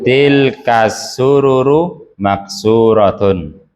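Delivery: slow reading with "sururu" vowels all kept short; careful model reading, short vowels. Shown as a demonstration. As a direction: read "Tilka sururu maksuratun" slowly, every vowel short. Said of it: "Itu surur kan pendek semua".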